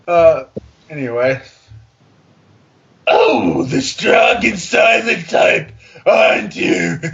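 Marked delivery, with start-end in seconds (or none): 3.1-7.0 loud, slow, drawn-out flirt; hammed-up seduction. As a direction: belt the line like a bad romance. Reading seductive fanfic dialogue again.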